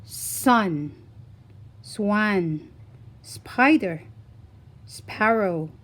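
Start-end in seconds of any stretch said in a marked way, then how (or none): none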